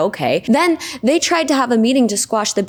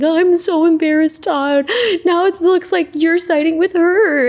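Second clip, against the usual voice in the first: high, hammed-up tipsy wail; mock sobbing; 9 semitones higher than usual. Wife sobbing over a drink to her husband, mock crying. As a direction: imitate a crying wife whining in embarrassment. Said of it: "She's crying, sobbing over a drink".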